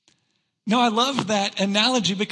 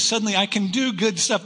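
normal talking voice

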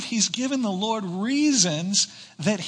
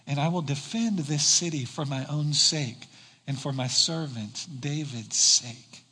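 scripture reading; 4 semitones lower than usual. Acted. Pastor reads God's answer to Hezekiah aloud, low and grave.

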